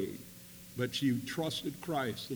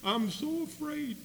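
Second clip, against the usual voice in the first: frightened wife; 11 semitones higher than usual. Retold or imitated. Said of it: "she kept telling me".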